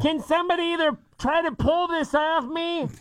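high, muffled-sounding office lady; office lady stuck in box. Pretend. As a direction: play a trapped, flustered office worker. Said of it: "office ladies walking around the office".

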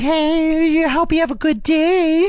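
very high, cooing voice for a pet; dog voice. Retold or imitated. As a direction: coo it sweetly to the dog, way up high. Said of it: "everyone has a dog voice".

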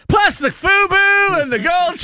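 high mock shout in Louisiana twang; Terry Bradshaw. Host mimicking the pundit crashing in, high hammed-up shouting. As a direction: burst in with a shrieked rant. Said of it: "Terry Bradshaw broke out, like the Kool-Aid man".

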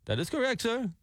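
high, imitated non-American accent, scammer on the phone. Host imitating the scam caller's non-American accent on air. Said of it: "he doesn't have an Americanized accent".

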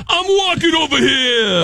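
angry, high New York shout; New York accent. Shown as a demonstration. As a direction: yell it like an irate New Yorker. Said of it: "Followed by New York".

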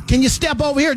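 a touch of a sing-song request; mock screener voice. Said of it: "He was talking about".

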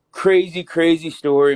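a real jolt of excitement before the tale; excited. Real, not acted. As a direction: hype up the crazy story. Launching into a wild story.

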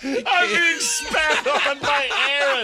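shouting through laughter, slow and high; laughing shout. Real, not acted. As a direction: yell it while cracking up. Getting spit on, laughing.

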